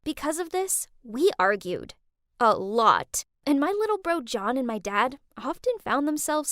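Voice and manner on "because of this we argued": usual speaking voice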